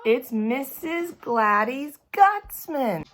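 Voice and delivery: high, loud, slow thrilled call; overjoyed child calling out; excited shout. Acted. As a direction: announce her name with pure delight. Junie cheers for lunch lady.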